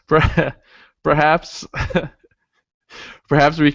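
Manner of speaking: giggling through the words; can't hold back a laugh. Real, not acted. Host breaks into laughter.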